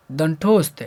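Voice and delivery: ordinary talk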